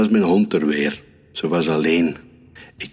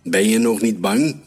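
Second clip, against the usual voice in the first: mildly raised, higher taunting question; calling out; 5 semitones higher than usual. A narrator's reading. Hunter taunts the hare, lightly calling out. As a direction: call out a playful threat. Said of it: "riep ik".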